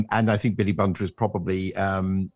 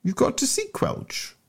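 questioning repeat; 3 dB quieter than usual. Acted. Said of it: "repeated Harry Wharton".

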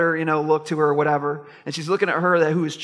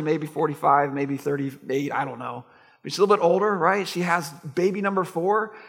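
ordinary delivery

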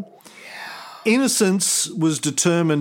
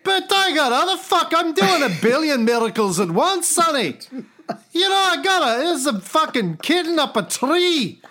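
put-on high, exasperated rant in Scottish accent; Scottish God; 8 semitones higher than usual. God griping about endless miracle requests, exasperated Scottish accent. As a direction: complain like an overworked, put-upon Scotsman. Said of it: "He must be Scottish".

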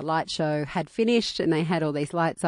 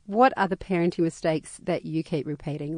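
ordinary talk, nothing marked

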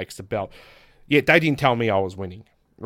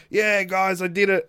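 high-pitched put-on cheer for the crowd; mock celebration; 8 semitones higher, 4 dB louder, slower than usual. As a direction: fake a triumphant celebration. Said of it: "I'm just gonna celebrate".

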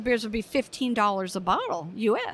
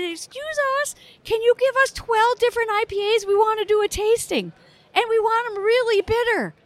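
put-on much higher, sweet old-lady voice; little old lady; 10 semitones higher, 3 dB louder than usual. Clerk imitates old ladies ordering bitter IPAs, high-pitched.

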